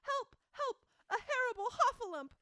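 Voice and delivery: high, loud frantic jumbled cries; frantic crying out. Retold or imitated. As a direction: panic so hard the words tangle. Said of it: "still crying out".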